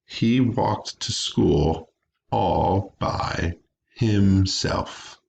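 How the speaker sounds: slow, spaced-out dictation. Shown as a demonstration. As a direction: read it slowly, pausing between word groups. Teacher dictating an example sentence.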